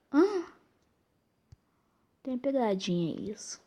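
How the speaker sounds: ordinary talk, nothing marked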